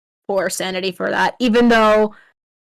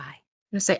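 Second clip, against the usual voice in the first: whispering; 4 semitones lower, 7 dB quieter than usual. Real, not acted. GM aside.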